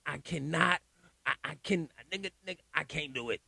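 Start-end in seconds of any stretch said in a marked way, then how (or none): none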